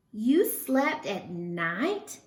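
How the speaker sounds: usual speaking voice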